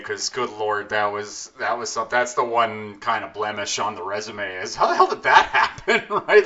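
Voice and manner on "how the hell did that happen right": laughing disbelief. Real, not acted.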